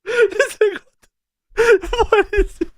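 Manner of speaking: high, squeaky words through laughter; laughing disbelief. Real, not acted. Streamer asking chat what this is, laughing. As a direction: ask it while still cracking up. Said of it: "Man, I'm crying".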